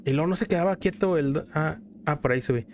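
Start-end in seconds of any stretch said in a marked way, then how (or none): none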